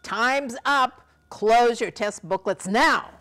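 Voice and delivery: loud and slow, like a proctor calling time; firm announcement. Shown as a demonstration. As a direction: call time firmly to the whole room.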